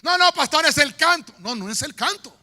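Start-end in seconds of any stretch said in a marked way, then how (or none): 0.0-1.3 raised, louder mock excuse; complaining churchgoer. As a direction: whine the excuse up high. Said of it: "ese director que puso el pastor". (feligrés quejándose en voz alta)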